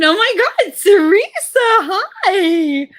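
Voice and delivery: very high, loud mock squeal; fake friendly greeting. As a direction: gush a phony hello, stretching the words. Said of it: "like, fake thing back and forth".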